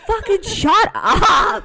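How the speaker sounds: loud, high, drawn-out exasperation; hammed-up exasperated outburst; mock self-scolding. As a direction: yell at yourself to stop, playfully. Scolds herself for rambling.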